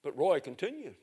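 ordinary talk, nothing marked

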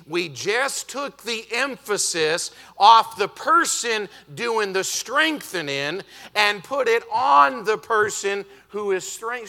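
normal talking voice

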